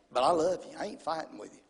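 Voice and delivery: low voice, softening at the end; gently refuse, as the patient wife; calm mimicry of a wife; imitating loving wife. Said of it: "She goes".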